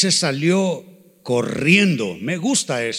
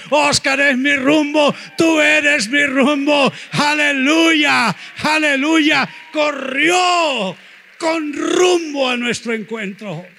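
slow, much higher, shouted preaching; preaching at full force; 7 semitones higher, 3 dB louder, slower than usual. Acted. Pastor shouting blessings over named church members, full-force preaching. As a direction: cry it out over the congregation, slow and high.

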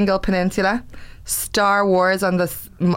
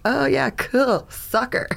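slow, much higher fake-keen reply; clueless enthusiasm; 7 semitones higher, slower than usual. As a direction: act keen but clueless. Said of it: "I was like".

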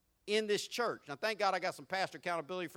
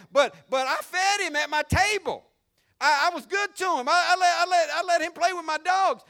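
much louder, higher desperate plea; rich man pleading; 10 semitones higher, 10 dB louder than usual. Acted. Rich man in hell crying out that he knows Lazarus, loud. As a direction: beg as the rich man name-dropping.

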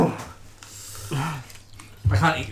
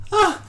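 pained yelp; 4 dB louder than usual. Real, not acted. Burning from hot sauce.

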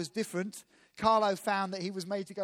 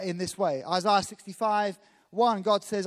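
ordinary delivery

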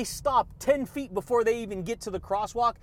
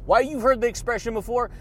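usual speaking voice

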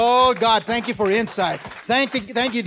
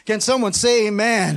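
normal talking voice

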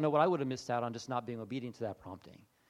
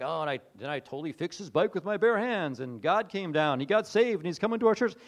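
loud, rising mock boast; mock triumph; 3 semitones higher, 6 dB louder than usual. Preacher mocking a grand miracle story, mock triumph. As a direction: build an over-the-top tale of triumph.

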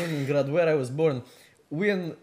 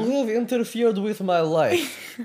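put-on higher, louder indignant Russian accent; mock outrage; 4 semitones higher, 4 dB louder than usual. Vladimir accusing his accuser back, mock outrage.

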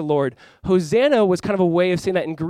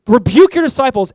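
Pharisees demanding; 7 semitones higher, 9 dB louder than usual. Retold or imitated. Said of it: "They say".

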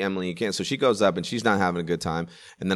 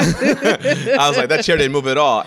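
laughing through the words, louder; can barely get it out for laughing; 7 semitones higher, 5 dB louder, slower than usual. Real, not acted. Laughing at own joke.

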